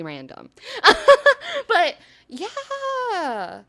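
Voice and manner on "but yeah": high bursting laugh, then a laughing but yeah; real burst of laughter. Real, not acted. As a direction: laugh it out for real before the words. Laughing at her own remark.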